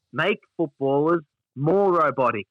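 mock slow robotic. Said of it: "sitting there going like".

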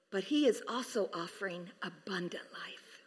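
soft unvoiced whisper; whispered emphasis. Real, not acted. Pastor hushing the congregation on eternal life, whispering. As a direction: hush it like a secret.

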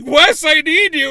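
high, loud mock desperation; panicked plea. Retelling a plea to her friend, hammed-up panic. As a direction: plead loudly as if freaking out.

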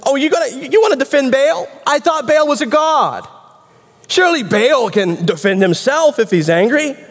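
high-pitched, incredulous taunt; mocking challenge. Joash defending his son against the crowd, mockingly incredulous. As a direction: throw their cause back at them, pitched well up.

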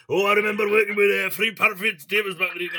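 puffed-up celebrity anecdote voice, sending up a crooner. Retold or imitated. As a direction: ham up a crooner's boastful recollection. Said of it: "Fucking hell, [name]".

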